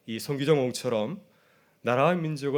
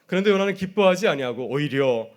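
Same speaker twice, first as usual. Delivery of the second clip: ordinary talk, nothing marked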